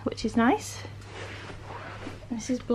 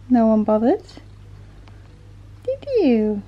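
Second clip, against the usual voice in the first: ordinary delivery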